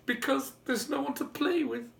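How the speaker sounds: put-on high childlike pleading; a little boy sniffling out his reason; small boy's voice. A narrator's reading. Little boy tells the giant why he cries, high. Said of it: "the little boy said".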